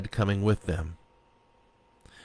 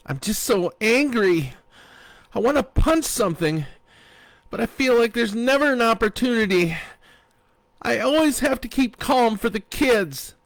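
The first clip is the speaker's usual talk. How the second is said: much higher and louder, rushed frustration; tearful venting; 14 semitones higher, 6 dB louder than usual. A narrator's reading. Sarah venting to her friend, high and upset. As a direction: let the pent-up rage spill out.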